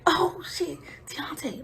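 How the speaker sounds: urgent hushed call; hushed warning to her friend; whispered. Retold or imitated. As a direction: whisper his name so they don't hear. Peeking at brother through window.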